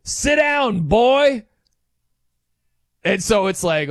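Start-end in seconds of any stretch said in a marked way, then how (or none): 0.0-1.4 higher-pitched imitated ballplayer's taunting yell; retold yell. Pitcher taunting the batter he struck out, mock yell. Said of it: "and he yells".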